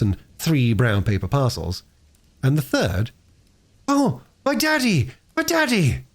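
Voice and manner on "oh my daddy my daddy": much higher, drawn-out cry of joy; a girl crying out at the sight of her father; joyful scream. Acted. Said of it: "That scream went like a knife".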